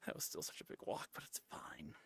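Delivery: breathy whisper, voice dropped away; whispering an aside to the audience about the walk. Real, not acted. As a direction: tuck it in as a private whisper.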